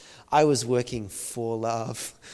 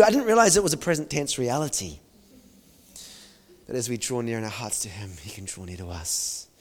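ordinary talk, nothing marked

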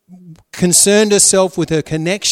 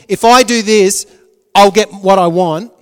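louder, determined plotting; a wife planning to win her husband, louder; scheming Leah; 3 semitones higher, 9 dB louder than usual. Retold or imitated. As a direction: talk yourself into the plan, louder. Repeating Leah's scheming.

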